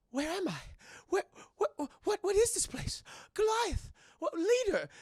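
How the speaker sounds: high, bewildered put-on voice; halting, confused character in an unfamiliar high voice; changed voice. Voicing Cold Stone waking confused, high strange voice. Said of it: "Why do I sound this way?".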